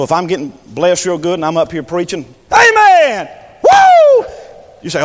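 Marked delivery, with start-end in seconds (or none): 2.5-4.3 high, loud amen and whoop; ecstatic shout. Pretend. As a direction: shout for joy in the pulpit. Acting out getting blessed.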